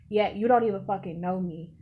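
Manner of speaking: ordinary delivery, nothing marked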